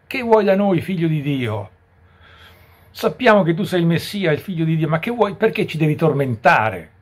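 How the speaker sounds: high, loud challenge; possessed man. Retold or imitated. Possessed man confronting Jesus, loud high protest. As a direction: cry out in protest at being tormented. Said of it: "l'indemoniato riconosce subito Gesù Cristo".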